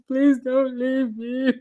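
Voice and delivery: slow, whiny mock sob; imitating his crying. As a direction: drag it out like a man begging through tears. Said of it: "He breaks down crying".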